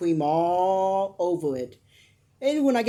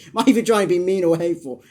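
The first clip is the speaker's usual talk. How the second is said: ordinary talk, nothing marked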